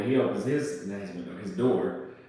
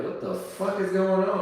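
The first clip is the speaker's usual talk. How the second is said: alarmed; 8 semitones higher than usual. Retold or imitated. Retold alarm at police stop.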